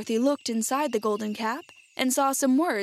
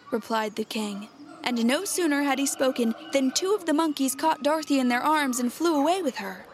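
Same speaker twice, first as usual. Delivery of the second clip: plain reading voice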